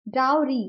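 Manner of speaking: Dowry as DOW-ree, quick light ree; dowry with "ow" stressed, short final ree; stress on first syllable. Shown as a demonstration. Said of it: "first वाले word पे stress दिया है as डाउ".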